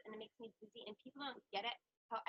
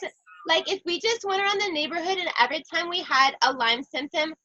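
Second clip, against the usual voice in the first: loud excited; 4 semitones higher, 16 dB louder than usual. Real, not acted. Loud excited hashtag banter.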